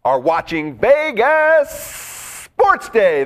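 slow, drawn-out showman opening; milk the show open, slow and grand; show host opening. Acted. Host welcoming viewers to the show, slow and showy.

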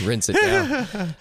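drawn-out goofy voice; silly comic voice. Pretend. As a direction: ham up the punchline. Joking about the bidet.

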